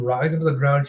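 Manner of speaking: ordinary talk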